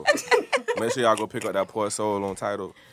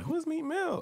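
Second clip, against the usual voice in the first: high-pitched; 4 dB quieter than usual. Retold or imitated. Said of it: "she was like".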